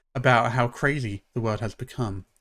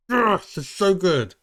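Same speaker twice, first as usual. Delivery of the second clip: gushing; 7 semitones higher, 4 dB louder than usual. Real, not acted. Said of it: "I gush over Michelle Obama".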